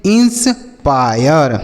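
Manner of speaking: Inspire broken and stretched, "ins... paa-yar"; slow demonstration. Shown as a demonstration. Teacher drills students on the word, slow and broken up. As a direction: draw out inspire very slowly in parts. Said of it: "क्या पढ़ेंगे?".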